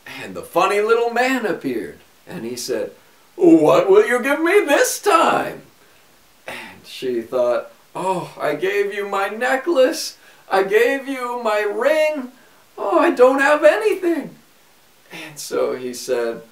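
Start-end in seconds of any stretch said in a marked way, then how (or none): 3.3-5.6 high, squeaky little man asking a price; a small trickster drives a bargain; sly little man. A narrator's reading. 7.9-14.4 put-on despairing girl. A narrator's reading. Queen laments having nothing.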